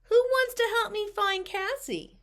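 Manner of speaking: high, eager question; excited asking. A narrator's reading. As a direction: ask it like an excited dog rallying helpers. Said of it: "He barked excitedly as if to ask".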